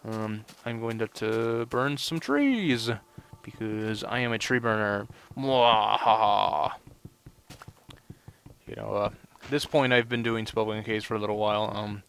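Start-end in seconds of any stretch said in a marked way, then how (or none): 5.4-6.7 slow mock evil cackle; evil laugh. Gamer gloating over burning trees, with a mock evil cackle. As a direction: cackle slowly like a cartoon villain. Boasts of being a tree burner.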